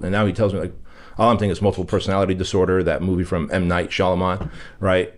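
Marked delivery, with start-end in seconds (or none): none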